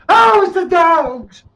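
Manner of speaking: high, drawn-out, loud mocking cry; exaggerated falsetto taunt; mock Texas fans. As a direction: mimic rival fans in a silly high shout. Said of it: "that's why they say it over in Texas".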